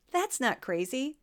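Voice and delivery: ordinary delivery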